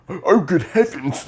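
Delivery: high, loud, drawn-out gasp of horror; mock shocked bystander. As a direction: exclaim it like a scandalized passerby. Mimicking shocked bystanders in the street.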